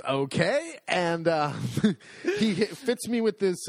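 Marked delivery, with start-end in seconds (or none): none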